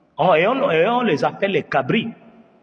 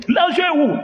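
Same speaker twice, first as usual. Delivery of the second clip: demanding shout; 11 semitones higher than usual. Acted. Robber yelling at the dunked migrant for money. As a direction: bark the question at him.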